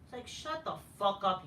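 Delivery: usual speaking voice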